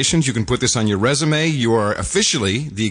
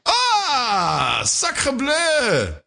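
put-on French accent; 11 semitones higher, much slower than usual. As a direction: exclaim it like a stage Frenchman. Mock French exclamation.

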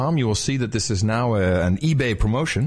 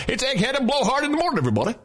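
radio host; 12 semitones higher, much faster than usual. Pretend. Mock morning show intro.

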